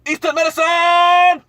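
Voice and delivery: announcer shout. Acted. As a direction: belt out the sponsor name. Announcing the sponsor.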